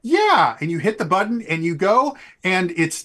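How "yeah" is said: high, loud burst of confidence; pumped-up yes, pitched way up; excited yeah. Acted. Rider psyched up before hitting the button, loud high yeah. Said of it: "And you're like".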